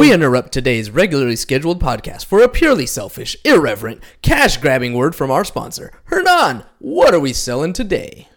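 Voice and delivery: big movie-announcer interruption; punchy, hammed-up trailer voice. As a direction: announce it like a dramatic film trailer. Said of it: "[name] likes to do his movie voice".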